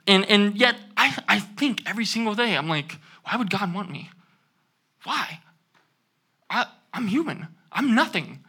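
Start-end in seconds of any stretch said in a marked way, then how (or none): 2.6-8.4 hushed, small voice of self-doubt; quiet self-doubt. Retold or imitated. To the congregation, voicing her own hushed doubt. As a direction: murmur it like a private fear.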